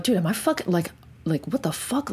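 freaked-out disbelief. Retold or imitated. Freaking out in the silence.

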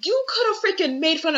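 high and loud exasperation; heated high burst. Real, not acted. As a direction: fling it out high.

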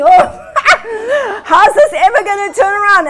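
high, laughing disbelief; laughing worry. Retold or imitated.

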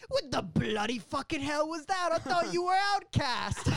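high, shouted mock outrage; outraged manager. Hugo Weaving berates the band after the show, shouting. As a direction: yell it in hammed-up British-style fury. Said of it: "after Hugo Weaving's like".

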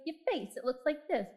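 hint of a small girl pointing it out; lightly voiced little girl; lightly voiced child. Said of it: "And she's like".

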